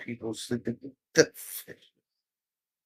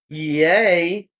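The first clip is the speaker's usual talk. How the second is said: usual speaking voice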